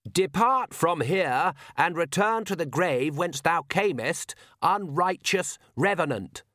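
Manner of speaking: high, slow ringing pronouncement; solemn exorcism. A narrator's reading. Priest Oates confronting the vampires, solemn exorcism. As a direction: cast him out with holy force.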